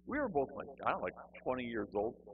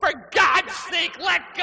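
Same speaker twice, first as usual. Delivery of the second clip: high, very loud, drawn-out yell; desperate mock shout; 16 dB louder than usual. Man shaken by the throat, desperate full shout. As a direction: holler for release at full comic panic. Choked man screams at zealot.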